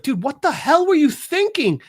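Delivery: high-pitched acted disbelief; mock-exasperated confrontation. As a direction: demand an explanation, voice leaping up. Nightwing confronts Batman in retold quote.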